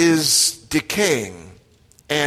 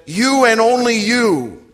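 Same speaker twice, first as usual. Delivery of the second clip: "you and only you" raised high, both "you"s held; emphatic YOU; 6 semitones higher, 5 dB louder than usual. Acted. Preacher voicing God to the congregation, emphatic stress on "you". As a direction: press "you" home, higher and louder. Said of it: "it's the word you that's so much emphatic".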